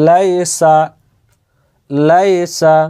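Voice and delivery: normal talking voice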